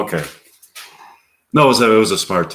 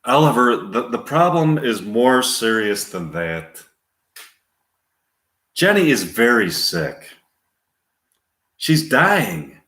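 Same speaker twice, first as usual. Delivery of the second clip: doctor breaking news; 4 semitones higher than usual. Acted.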